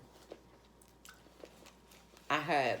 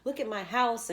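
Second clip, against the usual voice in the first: much higher, gushing with delight; greeting her late friend with delight, much higher; overjoyed greeting; 11 semitones higher than usual. Retold or imitated. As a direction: squeal it like seeing a lost friend again. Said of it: "And I was like".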